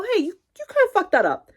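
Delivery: high, hesitant, softened correction; gentle correction. Acted. Coworker kindly pointing out a mistake, gentle. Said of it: "Obviously not like that, but they go".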